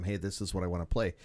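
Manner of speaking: ordinary talk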